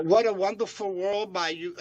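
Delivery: ordinary delivery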